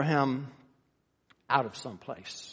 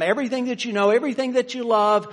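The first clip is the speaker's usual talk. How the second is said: louder, higher stress on "everything"; rising emphasis; 8 semitones higher, 7 dB louder, faster than usual. Acted. Preacher urging Abraham to leave, stressing "everything". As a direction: lean hard into each "everything".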